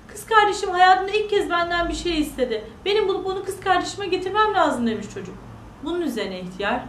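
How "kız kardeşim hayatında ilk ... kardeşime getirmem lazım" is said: put-on higher-pitched, earnest young boy voice; lightly voiced boy with a heartfelt plea; pleading boy. Boy tells the old man of his sister's first wish, pleading.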